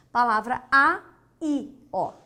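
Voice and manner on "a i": "a, i" with a pause, "a" louder; two separate syllables. Shown as a demonstration. Teacher contrasting aí with ai, split in two. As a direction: punch "a", pause, then a separate "i". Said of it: "são dois momentos".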